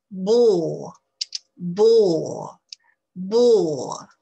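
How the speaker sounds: "Ball" with a long rounded O, loud. Shown as a demonstration. Teacher drilling the long O, loud. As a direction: stretch the O in "Ball". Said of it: "voyelle longue".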